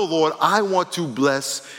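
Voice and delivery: normal talking voice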